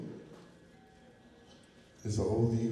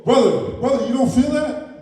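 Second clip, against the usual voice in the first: urgent calling; 6 semitones higher, 7 dB louder than usual. Acted. Eagle urgently calls brother.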